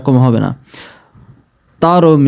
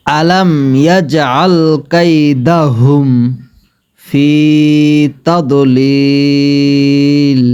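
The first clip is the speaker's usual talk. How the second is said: তাদলিল with heavy throaty ta, slow; much slower than usual. Shown as a demonstration. Teacher demonstrating heavy letter, slow তাদলিল. Recitation continues.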